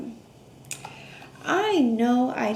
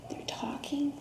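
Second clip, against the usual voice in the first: soft secretive whisper; 9 dB quieter than usual. Acted. Whispering about the girls talking.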